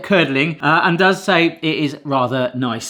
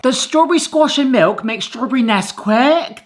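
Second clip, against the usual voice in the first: imitating a Bristolian accent; 6 semitones higher than usual. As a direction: mimic her Bristol vowels on "strawberry" and "Nesquik". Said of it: "That's a very Bristolian accent".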